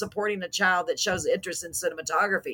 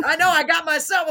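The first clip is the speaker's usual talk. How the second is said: ordinary talk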